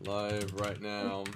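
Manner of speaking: low, very slow mock-official warning; slow, low announcer put-on; mock announcement. Mock formal warning to new joiner.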